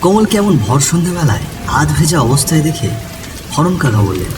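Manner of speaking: ordinary delivery, nothing marked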